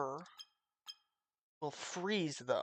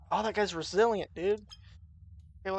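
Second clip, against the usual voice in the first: usual speaking voice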